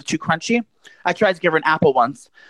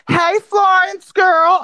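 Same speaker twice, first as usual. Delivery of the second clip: high drawn-out shouted greeting; calling out; 4 dB louder, much slower than usual. Retold or imitated. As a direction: call her name loud and slow, much higher. Calling out to Florence.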